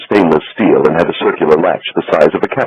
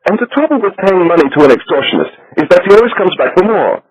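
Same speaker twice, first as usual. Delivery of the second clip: higher, fussy voice for the banker; little Swiss banker; 13 semitones higher than usual. A narrator's reading. Becker complains of extortion.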